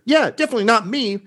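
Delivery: quick, high-pitched heckle; squeaky little interjection, thrown in quickly; voice from the back. Acted. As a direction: pipe up fast and high from the back. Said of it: "from the back of the room".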